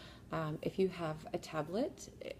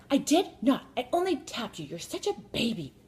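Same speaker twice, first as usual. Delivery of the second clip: loud, high denial, easing down at the end; mock indignant retort; 7 semitones higher, 9 dB louder than usual. Daughter calling her brother a baby, mock indignant yell. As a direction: snap back like a squabbling sister. Acted daughter snapping back.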